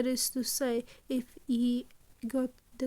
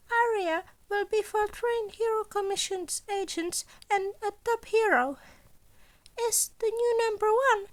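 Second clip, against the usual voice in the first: high, louder principal laying out the heist; Nezu voice; 8 semitones higher, 4 dB louder than usual. Acted. Principal briefing students on the gala heist, high and bright. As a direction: pitch it up like a cheerful small headmaster.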